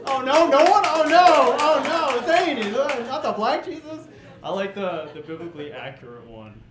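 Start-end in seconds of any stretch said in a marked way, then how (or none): 0.1-2.7 much higher, much louder, far-off squeal of dismay; hammed-up panicked squeal; mock horrified squeal. Mock dismay at silent crowd. 3.1-4.1 hammed-up shriek of dismay; mock horrified squeal. Mock dismay at silent crowd.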